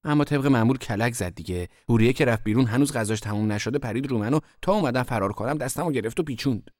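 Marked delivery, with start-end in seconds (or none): none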